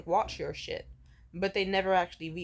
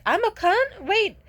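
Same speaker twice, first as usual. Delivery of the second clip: much higher, louder, squeaky mock voice; shrieking hammed-up impression; mocking imitation of her; 14 dB louder than usual. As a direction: parody her defensive panic. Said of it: "she kind of slid up on that and was like".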